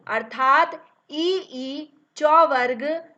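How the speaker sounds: loud, slow ई and च वर्ग; sounding out letters. Shown as a demonstration. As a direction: stretch out ई, then call च वर्ग loudly. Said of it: "इचु यसानाम तालु". (ई और च वर्ग का ज़ोरदार उच्चारण)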